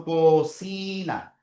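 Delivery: "Cocina" slow, in stretched syllables. Shown as a demonstration. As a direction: sound out "Cocina" with k first, then s. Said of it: "La primera suena como una K".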